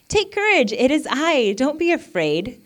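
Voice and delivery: high, loud, commanding reassurance; calling out. Acted. Jesus to frightened disciples on the lake, calling out. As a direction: call it out across the water.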